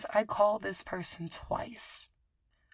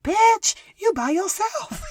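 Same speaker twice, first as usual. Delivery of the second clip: mock scream, much higher and louder; 13 semitones higher, 10 dB louder, slower than usual. The blizzard screams at her to mock her being alone, loud mock scream. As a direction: yell it like the blizzard taunting her. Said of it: "oh, it screamed".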